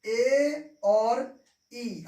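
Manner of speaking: letters drawn out slowly and loud, E and I stretched; slow loud dictation of letters; slow spelling out. Shown as a demonstration. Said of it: "आप ध्यान से देखिए कि दोनों के साथ में ए और ई".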